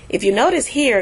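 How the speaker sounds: ordinary talk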